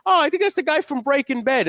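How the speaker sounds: lightly mocking crowd voice; mock dawning crowd. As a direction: play the crowd finally recognizing him. Crowd recognizing actor, mocked.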